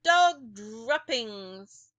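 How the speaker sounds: slow, loud, stretched witch cry; witch yell. A narrator's reading. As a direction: drag out the words like a sniffing witch. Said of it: "the yell of".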